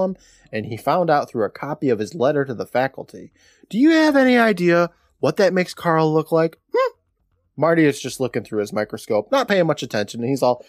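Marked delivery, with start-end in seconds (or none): 3.7-6.9 mock indignant, pitched up; indignant complaint. Acting out Carl complaining to his brother, mock indignant. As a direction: complain in hammed-up outrage. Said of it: "Carl paces around the room complaining".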